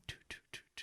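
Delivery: very quiet, voiceless little toots; soft imitated toots. Patient wheeled into hospital watching lights, soft imitated toots. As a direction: puff out tiny sounds for lights flicking past.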